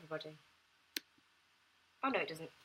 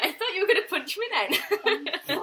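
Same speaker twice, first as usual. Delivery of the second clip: laughing, higher and louder; 12 semitones higher, 10 dB louder, slower than usual. Real, not acted. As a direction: laugh through the whole line. Laughing at the misheard word.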